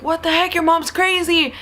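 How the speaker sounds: mock-terrified, high-pitched protest. Terrified friend complains about her mom, alarmed. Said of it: "He's terrified and he's like".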